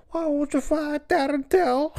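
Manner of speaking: very high, drawn-out Scouse squeal; exaggerated Scouse, stretched and barely intelligible; unintelligible Scouse. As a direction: mangle it in a thick mock Scouse accent. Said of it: "It just became more unintelligible".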